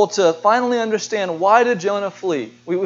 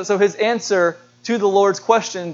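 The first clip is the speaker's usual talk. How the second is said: normal talking voice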